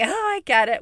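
high, loud put-on understanding through a smile; big-smile politeness. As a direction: imitate over-eager agreement with a big smile. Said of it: "big smile, you know, like".

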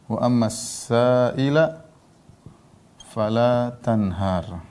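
very slow, drawn-out Quran recitation; slow, measured scripture repeat for learners; word-by-word recitation. Acted. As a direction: stretch the verse out, pausing between phrases. Same verse repeated word by word.

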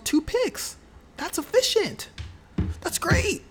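real surprise rising high at the good news; pleasantly surprised. Real, not acted. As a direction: react as the number beats your guess. Reads surprising stats aloud.

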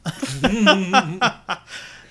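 bursting into high laughter; loud laugh. Real, not acted. Cohost laughing hard at the crude joke. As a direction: crack up loudly.

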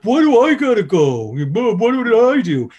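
put-on high, loud, indignant drunk whine; whiny drunk heckler. Drunk heckler being thrown out protests to the owner, high indignant whine. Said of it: "they're like".

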